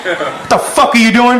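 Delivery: high, hollered drill-sergeant rebuke; angry platoon sergeant. Acted. Platoon sergeant catches two soldiers pairing up, angry holler. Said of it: "he got really angry. He goes".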